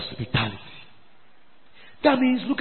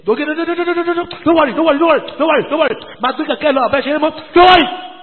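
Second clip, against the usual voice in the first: high, loud volley of repeated refusals; exasperated withdrawal; 9 semitones higher, 11 dB louder, faster than usual. Acted. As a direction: shout over the hesitation to call it off. Leader hastily withdrawing the request.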